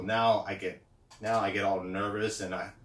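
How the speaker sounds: normal talking voice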